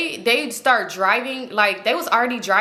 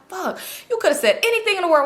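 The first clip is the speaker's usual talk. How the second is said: high, exasperated burst; self-reproach; 8 semitones higher than usual. Retold or imitated. Woman scolding herself over giving her name, exasperated. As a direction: kick yourself for a slip, pitched up.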